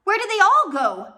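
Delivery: alarmed banker shouting in the dark; shouted. A narrator's reading. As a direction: yell it into the crowd. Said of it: "Poe shouted".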